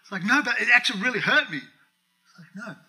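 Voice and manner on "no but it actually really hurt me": higher, louder, fast protesting; imitating an offended believer, whiny and fast; mock hurt whine. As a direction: whine as if wounded.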